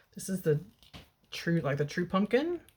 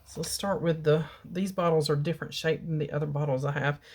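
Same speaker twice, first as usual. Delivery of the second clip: ordinary delivery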